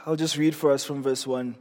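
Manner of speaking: ordinary delivery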